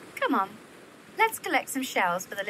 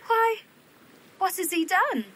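sudden high, louder question; alarmed surprise; 8 semitones higher, 5 dB louder than usual. Acted. Ethelberta startled by her sister's confession of love. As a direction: jump in, startled and worried.

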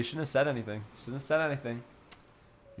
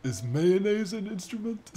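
higher, dopey cartoon voice; derpy Patrick; 7 semitones higher than usual. Doing Patrick for chat, a dopey higher voice. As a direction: ask it like a clueless starfish. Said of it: "Patrick's just like a derpy".

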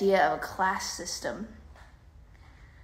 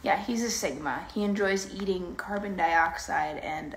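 ordinary delivery

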